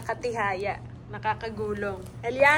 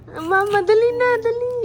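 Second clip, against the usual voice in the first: eager urging; 10 semitones higher, 9 dB louder than usual. Real, not acted. Mother urging baby to say mama, high excited coaxing.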